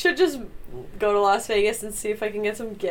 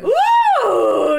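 excited cheer; 7 dB louder than usual. Real, not acted. Cheering Vegas plan.

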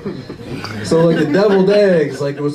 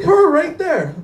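accusing mom; 12 semitones higher, slower than usual. Retold or imitated. Mom pointing out the woman.

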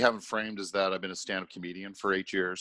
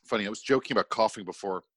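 normal talking voice

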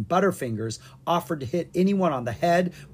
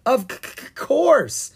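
nervous stammer rising high on course; 12 semitones higher, 6 dB louder, slower than usual. Acted. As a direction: trip over the word, caught out. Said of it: "Ella stammered".

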